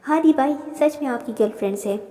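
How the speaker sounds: plain reading voice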